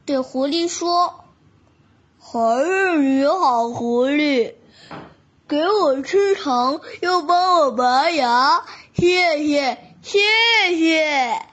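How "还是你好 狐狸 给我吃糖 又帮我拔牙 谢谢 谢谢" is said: toothless lisping tiger; missing front teeth. Acted. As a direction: air leaking through gap teeth. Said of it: "他用漏风的声音".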